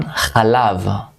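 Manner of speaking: chalav, with a throaty ch at the start; Hebrew word model. Shown as a demonstration. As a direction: model chalav slowly for learners. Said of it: "Chalav is milk".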